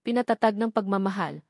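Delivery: usual speaking voice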